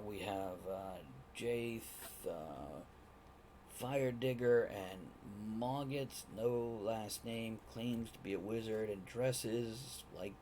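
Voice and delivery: slow, hushed report to the boss; quietly, haltingly announce the visitors; lightly voiced assistant. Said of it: "you can hear him telling Rajan".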